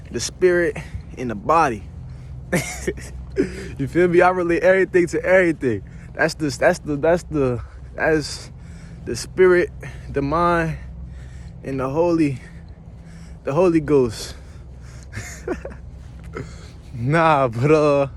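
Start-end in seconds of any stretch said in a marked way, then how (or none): 1.9-3.7 quiet breathy laugh to himself; soft chuckle. Real, not acted. Amused at own tree analogy. 14.8-16.6 quiet, high breathy laugh; small amused laugh under the breath; soft giggle. Real, not acted. Amused at saying Holy Ghost.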